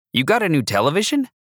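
high, quick surprised question. Acted. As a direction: blurt it out in disbelief. Carter surprised at new TV.

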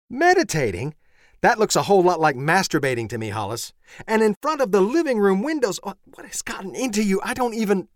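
high, loud and fast, scolding; indignant wife, voice up high; scandalized wife. Acted. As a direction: rattle off the outrage at him.

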